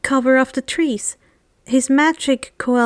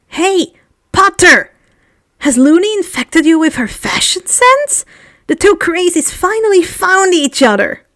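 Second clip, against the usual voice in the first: loud, high, sneering schoolgirl jeer; nasty bully call-out; 6 semitones higher, 6 dB louder than usual. A narrator's reading. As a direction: taunt them across the hall, mean and loud. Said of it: "called out nastily".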